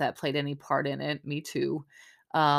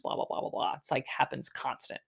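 dismissive; 4 semitones lower, 5 dB quieter than usual. Real, not acted. Dismissive aside about editing.

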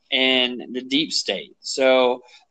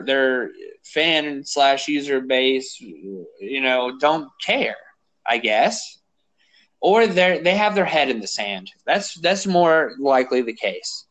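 ordinary talk, nothing marked

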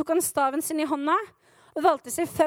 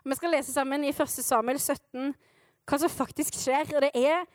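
ordinary talk

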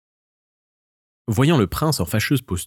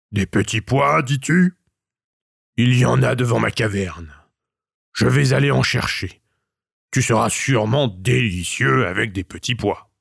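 troll. A narrator's reading. Troll voice in story.

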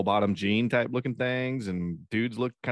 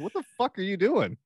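imitating the sheriff; 10 semitones higher than usual. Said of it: "He goes".

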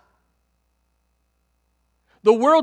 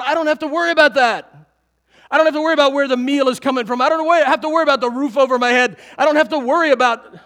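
loud, higher, emphatic declaration; carefree insistence; 4 semitones higher, 7 dB louder than usual. Pretend. Preacher playing a five-year-old whose father is God, loudly carefree. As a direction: proclaim it as a confident child would.

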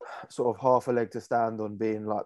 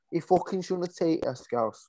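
put-on botched Manchester accent drifting towards Scouse; raised-pitch go at a Manc accent; mock Manc that lands near Scouse; attempted Manc accent; 5 semitones higher than usual. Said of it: "if he's a Manchester, he'd be like".

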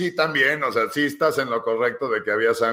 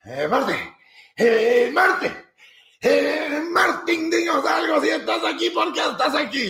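much higher, sing-song calls of the name; mock preacher calling; 13 semitones higher, slower than usual. Pastor urging Martín to speak, high sing-song. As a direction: coax him like an eager pastor. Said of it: "y entonces me dice".

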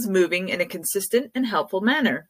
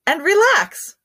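calm breathing cue; 4 semitones higher than usual. Shown as a demonstration. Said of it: "guided relaxation".

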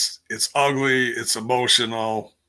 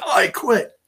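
a lightly voiced, higher I quit; imitating Edge; 10 semitones higher, 4 dB louder than usual. Recapping the show, mocking Edge's quit with a lighter, higher voice.

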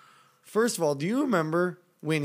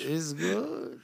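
mock Spanish accent; 3 dB quieter than usual. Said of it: "terrible at accents".

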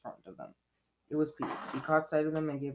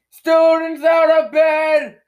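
very loud, high, drawn-out holler; full-force high-pitched yell; bellowing; 22 dB louder, much slower than usual. Acted. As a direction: bellow it out like an alarm. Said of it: "Peeves bellowed".

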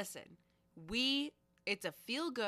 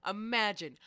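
normal talking voice

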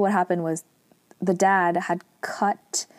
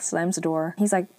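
ordinary delivery

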